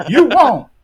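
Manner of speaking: high, loud comeback with a laugh; mock-indignant retort. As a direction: blurt it out high, half laughing. Retold indignant comeback in an argument.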